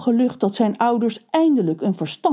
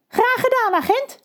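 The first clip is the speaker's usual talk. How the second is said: very high, chirpy crow reply; young crows. Acted. Young crows answering the butler, very high. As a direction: squeak it out brightly.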